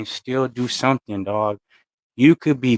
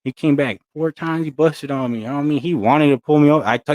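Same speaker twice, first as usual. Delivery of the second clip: usual speaking voice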